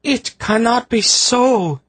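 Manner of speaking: high, slow anguished wail. A narrator's reading. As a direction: wring the words out in anguish. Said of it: "Tsaradis wailed".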